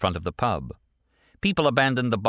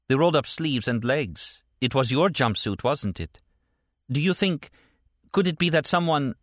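higher woman's voice, working it out; pitched-up character voice, questioning; Stella, dawning worry; 7 semitones higher than usual. Acted. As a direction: piece it together in a lifted, tentative voice. Stella realises whose jumpsuit it was.

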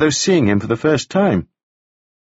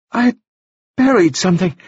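put-on much higher, bright boyish voice; high bright young gentleman; 9 semitones higher, 3 dB louder than usual. A narrator's reading. Wounded Staines greeting Taufari, high and bright. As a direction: lift it up high and bright like a dazed young toff. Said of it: "speaking in a voice that was high and very bright".

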